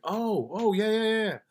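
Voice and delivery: high, dawning oh-yeah of realization; sudden recognition. Retold or imitated. Recalling the caller he once talked to, high surprised recognition. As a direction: it suddenly clicks.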